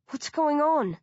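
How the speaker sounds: high confused question; lost and bewildered. A narrator's reading.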